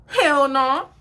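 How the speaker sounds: loud, high, emphatic refusal; defiant refusal. Retold or imitated. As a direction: refuse loudly and flat-out. Retold refusal before getting out.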